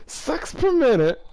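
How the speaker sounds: barely holding in the laugh. Real, not acted. Laughing at absurd fanfic line.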